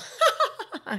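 a big burst of staged laughter on cue; big fake laugh. Shown as a demonstration. Actress showing her on-cue laugh from the scene, a big burst of laughter. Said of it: "I do a big laugh".

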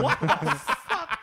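high, slow, laughing what the fuck; stunned and laughing in disbelief; shocked disbelief. Retold or imitated. Teen reacting to the lady's insult, high and slow. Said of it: "And I'm like".

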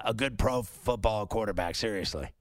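usual speaking voice

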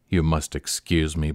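ordinary delivery

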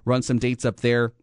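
normal talking voice

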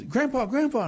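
put-on high child's voice calling Grandpa; little boy calling. Grandfather retelling a bedtime story, voicing the boy calling out high. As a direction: plead like a small boy in bed. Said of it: "The little boy says".

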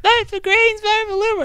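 very high-pitched pestering canvassers; mock election-day hawker; mimicked campaigners. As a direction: imitate pushy campaigners in a squeaky high voice. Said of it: "I'm loving both of those voices".

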